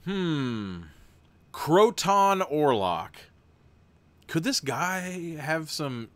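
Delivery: even, steady storybook read; story narration. A narrator's reading. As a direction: read the gamebook aloud to the viewers. Reads gamebook narration.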